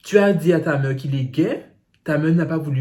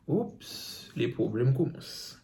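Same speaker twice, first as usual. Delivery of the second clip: whispered oops; 9 dB quieter, slower than usual. Pretend. Reacting to the sister's answer.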